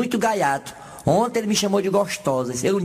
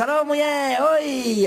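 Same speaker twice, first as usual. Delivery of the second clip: high cheerful call; 7 semitones higher, much slower than usual. Acted. Japanese man calls wife.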